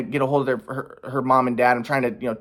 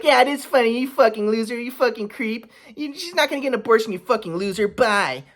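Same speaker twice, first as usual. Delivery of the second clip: higher, sneering mom insults; mocking mom voice; 7 semitones higher than usual. Son imitates mom insulting him, higher mock voice. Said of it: "Mom's like".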